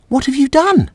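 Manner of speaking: high little voice copying the words. Real, not acted. Child echoing the reader, very high.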